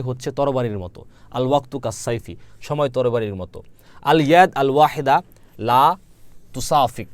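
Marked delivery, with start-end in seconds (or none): 4.0-7.1 আল ইয়াদ আল ওয়াহিদা লা spelled out slowly and loudly; slow model phrase. Shown as a demonstration. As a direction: lay each word down slowly for learners. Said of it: "এক হাত". (ধীরে ধীরে উচ্চারণ করে দেখানো)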